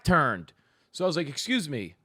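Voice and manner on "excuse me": "Excuse me" said softly like a customer flagging staff; polite and businesslike; clientele tone. Retold or imitated. Calling the waitress who had her back turned: soft, businesslike. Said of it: "it was still in clientele tone".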